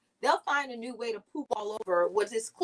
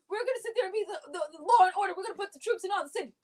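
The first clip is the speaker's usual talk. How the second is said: mock Republican boast; 8 semitones higher, faster than usual. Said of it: "The Republicans are like".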